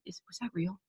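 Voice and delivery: a hushed, doubting question to herself; quietly asking if it was real; doubtful hush. Retold or imitated. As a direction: drop to near silence, unsure. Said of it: "and it's like".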